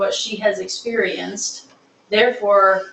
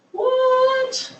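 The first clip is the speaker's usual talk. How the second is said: very high, drawn-out mock amazement; amazed. Kid discovering kinds of chocolate, acted high-pitched amazement. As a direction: squeal it out in playful astonishment.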